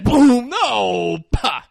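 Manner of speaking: loud high gunshot noise then a yelled no; mock gunshot boom and cry. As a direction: burst out like a sudden shot. Acting out the shooting scene.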